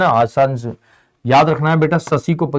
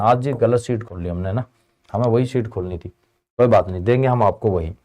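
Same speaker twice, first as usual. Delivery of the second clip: ordinary talk, nothing marked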